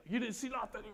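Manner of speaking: high, comic cover-up; sneaky denial. Retold or imitated. Patient sneaking out after being caught, high sneaky denial. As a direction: deny it while slipping away.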